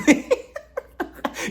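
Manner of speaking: high squealing laugh; high burst of laughter. Real, not acted. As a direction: crack up at his own joke. Laughing at own joke.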